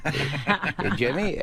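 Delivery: lightly voiced goofy comedian on the phone; Adam Sandler. Guest retelling Sandler answering the phone, light impression. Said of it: "Adam got on the phone. He's like".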